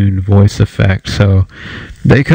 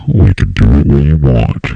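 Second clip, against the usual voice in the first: sung low, pitched down; imitating a pitched-down vocal cut; 8 semitones lower than usual. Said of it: "That [name] song".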